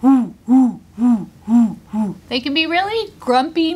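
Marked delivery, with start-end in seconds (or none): 0.0-2.2 loud monkey hoots; baboon hoots. Shown as a demonstration. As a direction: hoot like a moody baboon. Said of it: "And everybody go".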